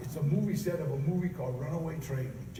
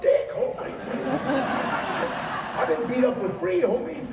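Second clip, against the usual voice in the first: much higher and louder, bursting with laughter; laughing punchline; 9 semitones higher, 8 dB louder than usual. Real, not acted. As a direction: crack up while delivering the punchline.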